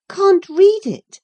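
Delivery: high, slow, incredulous cry; high disbelief, each word drawn out; astonished cry. Acted. Catherine to Hareton, a high astonished cry. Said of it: "cried Catherine".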